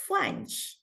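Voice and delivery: hushed, breathy French-accented word; mock French pronunciation, barely voiced. Shown as a demonstration. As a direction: say it softly like a French speaker. Mimics French way of saying it.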